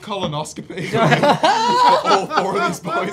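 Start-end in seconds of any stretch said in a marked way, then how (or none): 0.9-3.1 laughing. Real, not acted. Laughing absurd joke.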